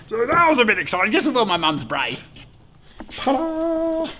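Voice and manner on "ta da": high, drawn-out ta-da; high-pitched mock flourish; playful reveal. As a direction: sing it out like a little magic reveal. Showing the hive to viewers.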